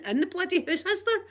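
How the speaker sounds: high exasperated challenge; raging. Retold or imitated. Customer demanding agreement about the heating, raging. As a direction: re-enact an irate demand. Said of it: "I was absolutely raging".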